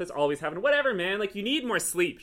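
loud, high acted protest; playful exasperation. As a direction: brush off the grumbling cat loudly. Bantering with grumpy woken cat.

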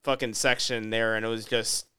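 normal talking voice

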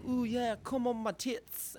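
high, slow, badly faked English woman; hammed-up Englishwoman in a high-pitched drawl; bad English accent. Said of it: "I'm so bad at accents".

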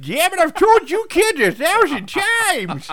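put-on high, loud, slow cranky old man. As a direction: scold like a grumpy old hobo. Acts the cranky hobo.

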